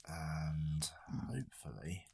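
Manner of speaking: heavy sighing whisper; dramatic sigh. Real, not acted. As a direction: sigh the words out slowly. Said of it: "me sighing heavily into the microphone".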